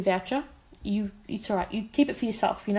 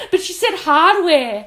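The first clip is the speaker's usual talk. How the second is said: slow, louder words through laughter; laughing; 6 semitones higher, 8 dB louder, slower than usual. Real, not acted. Telling her co-host about the hardware mix-up, laughing. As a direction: say it while still laughing.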